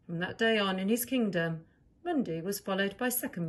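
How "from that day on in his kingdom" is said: usual speaking voice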